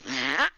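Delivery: loud imitated nose blow; comic snorting honk. Tearful wizard blowing his nose into his beard, honking. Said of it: "blows his nose".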